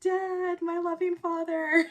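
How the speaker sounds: high, loud, overly cheerful greeting; forced cheer. Retold or imitated. Daughter answering her strict dad's call, forced cheerful. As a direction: sweeten it up to butter up dad. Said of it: "trying to be cheerful".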